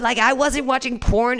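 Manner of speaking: usual speaking voice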